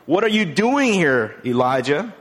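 forceful questioning. Acted. Preacher voices God questioning Elijah, forceful. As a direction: put the question high and strong.

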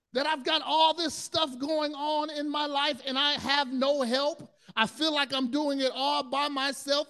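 exhausted, pleading outburst; overwhelmed plea. Retold or imitated. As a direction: pour out the burden in frustration. Overwhelmed believer's complaint.

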